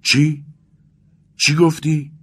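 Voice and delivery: high, loud, startled shout; shouted question. A narrator's reading. Friend bends over mumbling Vasya, shouting in alarm. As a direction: shout it, pitched right up. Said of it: "آرکادی فریاد زد".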